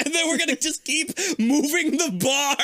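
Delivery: high laughing delivery. Real, not acted. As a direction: talk through giggles. Laughing while joking.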